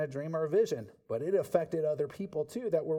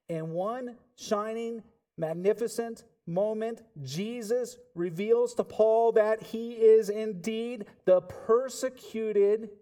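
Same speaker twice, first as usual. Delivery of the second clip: slow, high, loud proclamation; climactic preaching; 5 semitones higher, 4 dB louder than usual. Acted. Preacher at the climax, slow and soaring. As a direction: proclaim it like a revelation.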